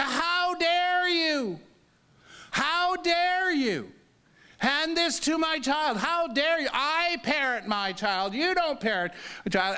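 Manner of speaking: high, slow, outraged how dare you; imitating the furious mother scolding; angry mother. Mother scolds stranger over card to her son, outraged high voice. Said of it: "And she said".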